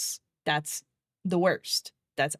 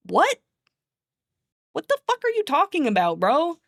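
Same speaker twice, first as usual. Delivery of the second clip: much higher, incredulous What; indignant, can't believe it; outraged disbelief; 9 semitones higher than usual. Real, not acted. Venting to chat about the clinic, outraged and incredulous.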